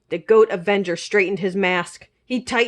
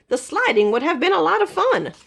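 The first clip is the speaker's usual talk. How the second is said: ordinary delivery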